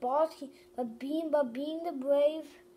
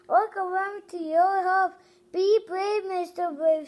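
urging call; 4 semitones higher, 6 dB louder than usual. Acted. Character urges courage.

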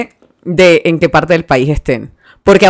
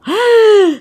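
mock panic; 9 semitones higher, slower than usual. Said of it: "yo era como".

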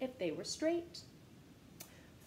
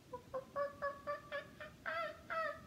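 delighted laugh; 6 dB quieter than usual. Real, not acted.

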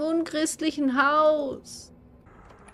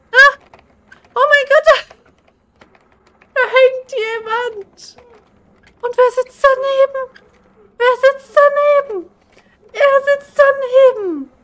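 horrified shouting; 10 semitones higher, 10 dB louder than usual. Real, not acted. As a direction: scream in horror at the body. Finds a hanged figure. (entsetztes Schreien)

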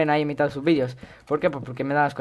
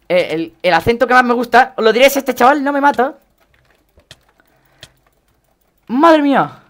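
high, loud, urgent blurting; real in-game alarm, voice pushed up high; alarmed shouting; 8 semitones higher, 10 dB louder than usual. Real, not acted. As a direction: keep talking at a panicked yell. Alarmed as player attacks mid-sentence.

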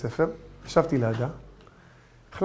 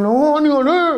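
determined husband; 9 semitones higher, 6 dB louder, slower than usual. Acted. Husband voiced resisting.

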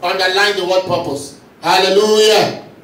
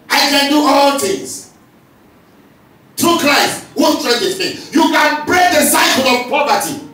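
higher, louder, ringing declaration; preacher shouting scripture and victory; preaching shout; 6 semitones higher, 3 dB louder than usual. Acted. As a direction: proclaim it to the whole congregation.

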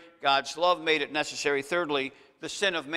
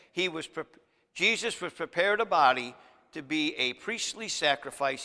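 usual speaking voice

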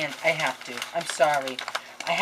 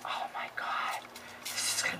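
awed whisper; 7 dB quieter than usual. Real, not acted. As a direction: breathe it in awe. Admiring gift.

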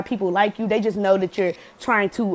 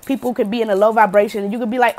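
ordinary delivery

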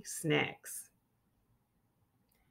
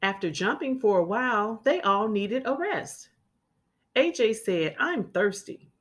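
clear, lively storybook reading; children's story read-aloud. A narrator's reading. Teacher reading a picture book to children, lively. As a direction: read it like storytime for little kids.

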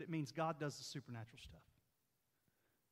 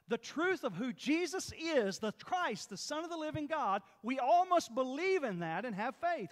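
raised, emphatic sermon voice; full-force preaching; 9 semitones higher, 11 dB louder than usual. Real, not acted. Preacher teaching the congregation about the called-out church, forceful and emphatic. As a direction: preach it out, punching the key words.

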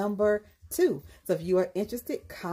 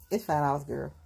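ordinary talk

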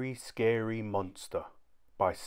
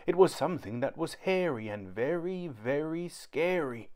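spooky; 5 semitones higher than usual. A narrator's reading. Spooky narration build.

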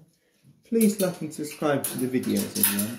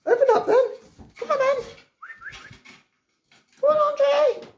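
coaxing pet talk; 4 dB louder than usual. Real, not acted. Coaxing ferret to eat.

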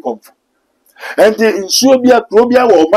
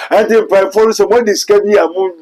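ordinary talk, nothing marked

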